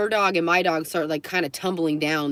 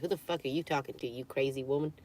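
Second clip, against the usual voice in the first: muted pet's grumble; 3 semitones lower, 9 dB quieter, faster than usual. Pretend. Voicing the pet's complaint.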